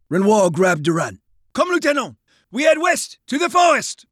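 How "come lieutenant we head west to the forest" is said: high, pressing call; urgent command. A narrator's reading. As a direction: hurry the lieutenant along. Officer urging lieutenant to flee.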